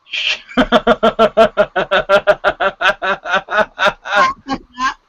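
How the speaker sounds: drawn-out real laughter; giggling fit; long laugh. Real, not acted. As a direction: laugh at your own joke. Laughing at his own joke.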